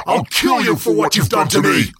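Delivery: high, loud screaming threat; furious scream. Acted. Student attacking sensei, screaming high and loud. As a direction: scream it as you leap.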